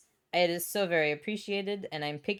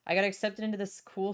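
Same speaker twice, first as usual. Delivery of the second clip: usual speaking voice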